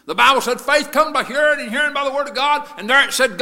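high, shouted sermon cry; full-force preaching. Real, not acted. Preaching about blind men and Jesus, full-force shout. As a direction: preach it loud and high to the whole church.

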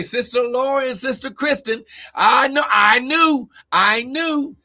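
ordinary delivery

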